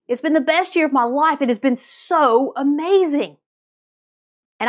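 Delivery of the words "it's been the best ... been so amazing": higher, bright with excitement, building to amazing; excited. Pretend. Imagined listener telling host their year, excited gushing. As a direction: gush about the best year.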